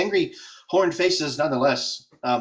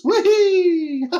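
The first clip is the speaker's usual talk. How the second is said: high, loud joyful squeal; gleeful whee; 7 dB louder than usual. Acted. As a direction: squeal like a kid on a ride. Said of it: "He's just spinning on the chair".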